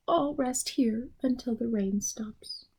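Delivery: soft unvoiced whisper. Worn-out Mister Bird sheltering from rain, whispering. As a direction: whisper it wearily.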